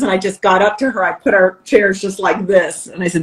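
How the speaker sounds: normal talking voice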